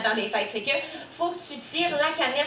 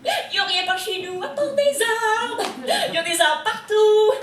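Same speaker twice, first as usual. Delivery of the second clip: exasperated; 8 semitones higher, slower than usual. Acted. Exaggerated rant about trees.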